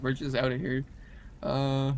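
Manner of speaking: ordinary talk, nothing marked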